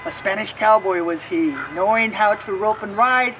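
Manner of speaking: put-on lilting rhyme read aloud to kids; reading to children. A narrator's reading. Librarian reading a picture book to children, lilting. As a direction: read each verse like storytime.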